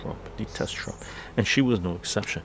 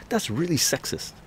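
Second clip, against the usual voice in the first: higher-pitched retold complaint; mock indignant mother; 6 semitones higher than usual. Imitating the mad mother's accusation, mock indignant. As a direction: imitate her offended protest. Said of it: "she got really mad".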